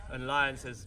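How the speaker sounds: usual speaking voice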